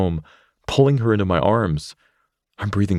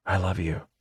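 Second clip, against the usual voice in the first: put-on softer girl's voice, nearly hushed; Rachel, tender; 7 dB quieter than usual. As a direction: promise it to him gently. Rachel comforts Zane tenderly.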